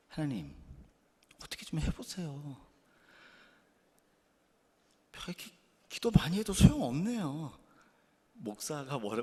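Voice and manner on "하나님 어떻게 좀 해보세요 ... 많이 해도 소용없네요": 불평하는 기도. Retold or imitated. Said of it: "뭐라고 기도하는 줄 아세요?".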